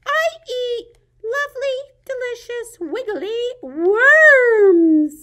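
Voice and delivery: very high bird voice, swelling loud; chirpy little bird. Acted. Bird tells the frog what it eats, high bird voice. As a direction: squeal it high, stretching the last word long. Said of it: "said the bird".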